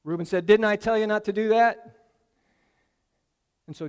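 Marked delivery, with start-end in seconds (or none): 0.5-2.0 louder, told-you-so rebuke; reproachful outburst. Acted. Reuben scolding his brothers, loud and reproachful. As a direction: throw the blame at them.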